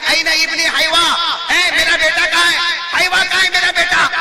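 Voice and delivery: high, loud holler across a crowd; calling out for son. Retold or imitated. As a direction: act a mother shouting for her son. Old mother calls through the crowd.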